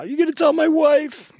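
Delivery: very high, wailing imitation; mock crying. Officer imitating the John crying about his wife, mock wail. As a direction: wail it like a man badly panicked, hammed-up. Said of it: "he starts really crying for real now".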